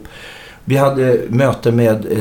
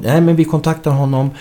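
voiced exec; 4 semitones higher than usual. Quoting the exec's answer.

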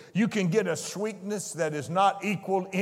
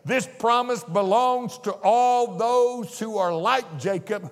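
ordinary delivery

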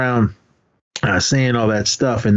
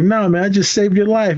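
higher-pitched retold reassurance; Miyagi; 11 semitones higher than usual. Miyagi answers Daniel's complaint, lightly acted protest. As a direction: playfully imitate a mentor protesting. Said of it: "Miyagi was like".

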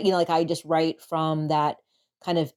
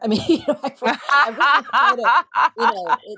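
laughing; 8 semitones higher, 7 dB louder, faster than usual. Real, not acted. Laughing about her El Camino poem.

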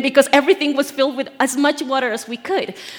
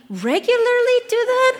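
nosy gossip; 11 semitones higher than usual. Retold or imitated. Gossiping people quoted.

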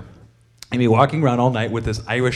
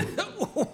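laugh; 10 dB quieter than usual. Retold or imitated. Fan's punchline laugh.

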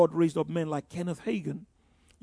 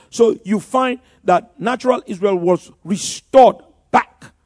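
louder, rising preacher's emphasis; preaching; 11 dB louder than usual. Acted. Preacher to congregation about Jubilee, loud preaching. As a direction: preach it out to the room. Preacher on Israel restored 1948.

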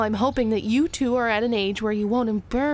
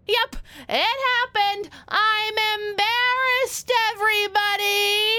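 theatrical announcement; 8 semitones higher than usual. Acted. Mom announces embarrassment loudly.

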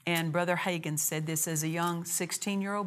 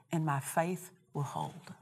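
understated quote; 6 dB quieter than usual. Retold or imitated. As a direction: say it quietly, almost in passing. Quotes phrase before repeating it.

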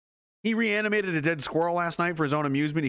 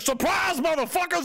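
much higher shouted reveal; mock hero shout; 13 semitones higher than usual. Brock Lesnar revealing himself to the activists, shouted. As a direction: yell the reveal triumphantly. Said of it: "he's like".

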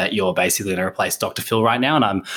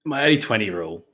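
usual speaking voice

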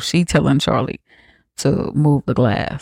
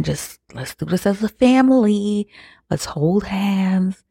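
higher, coaxing, mock-sweet plea; pleading mother; 5 semitones higher than usual. Mother coaxing her husband and son into the ritual, higher and sweet. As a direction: lift the voice and coax them gently.

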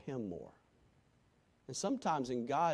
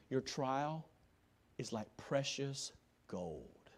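tender hushed promise; 8 dB quieter, slower than usual. Real, not acted. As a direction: lean in and say it softly. Hushed quoting of Peter.

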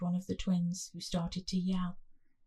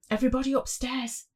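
urgent hissed order; 5 semitones higher, 4 dB louder, faster than usual. A narrator's reading. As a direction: hiss it out fast. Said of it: "I hissed".

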